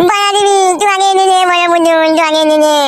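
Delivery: put-on high, pleading child's voice; whiny little boy. Small son begging father for big doll, whining. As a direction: beg like a spoilt kid.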